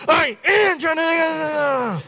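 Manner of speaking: high, slow strained shout; yelling back. Retold or imitated. Pastor yelling back at the grandmother, slow and strained. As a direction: bellow it back in frustration. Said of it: "I yell at her".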